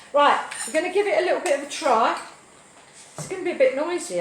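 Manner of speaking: loud and fast over the noise; talking up over a noisy blender. Real, not acted. Blender switched on, shouting over it.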